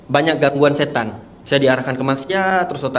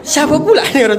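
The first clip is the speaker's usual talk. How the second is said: high, loud protest through laughter; laughing disbelief; 11 semitones higher, 4 dB louder than usual. Real, not acted. Guest swearing to the host he didn't know, laughing loudly. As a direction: insist it's true while laughing. Amused retelling of his own disbelief.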